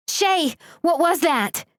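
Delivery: high, slow frightened call; panicked yell while running; alarmed shout. Acted. Frightened friend calls while running.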